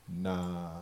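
very low, soft brush-off; mock quiet dismissal. Comic turning down a man in a suit, low quiet dismissal. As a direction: drop it right down, barely voiced.

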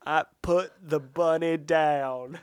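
slow mock Southern Con Air drawl; slow Southern accent like Cage in Con Air. Friend imitates Cage's Con Air Southern accent. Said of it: "You're not great at the accent".

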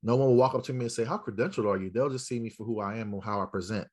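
ordinary talk, nothing marked

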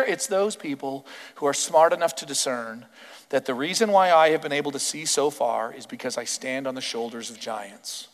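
ordinary talk, nothing marked

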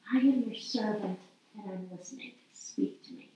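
soft prayer fading to a whisper; prayerful listening. Acted. Servant praying to God for a word, hushed. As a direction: drop away to a hushed, breath-only plea. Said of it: "We say, "Here, God".

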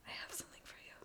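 softly whispered secret. Real, not acted. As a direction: whisper it to tease her. Teasing secret gift.

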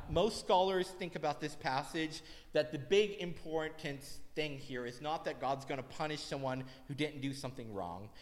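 ordinary talk

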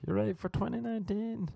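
sing-song imitation of an eager Swedish-ish driver; over-excited Uber driver. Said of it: "That was kind of his voice".

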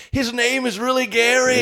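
high, slow, amazed report; lightly voiced friends in disbelief; mock amazed friends. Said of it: "They're like".

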